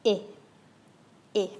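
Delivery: short sharp "Eh" with lips pulled back; French é sound. Shown as a demonstration. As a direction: spread the lips wide for "Eh". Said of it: "pull your lips right back and up".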